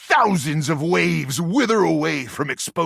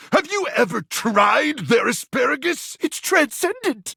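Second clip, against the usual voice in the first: indignant excitement; 11 semitones higher than usual. Acted. Defends beloved asparagus festival.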